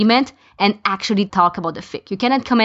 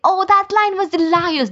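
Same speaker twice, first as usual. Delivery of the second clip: pitched way up, playful gush; gushing fan comment; 10 semitones higher than usual. Retold or imitated. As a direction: mimic an excited listener raving about a line. Example of an enthusiastic listener comment.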